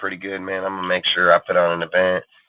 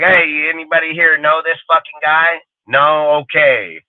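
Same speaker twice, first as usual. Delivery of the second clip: higher, louder mock call over an intercom; mock announcement; 6 semitones higher, 3 dB louder than usual. On the Times Square intercom asking the crowd about the YouTuber, a mock public call-out.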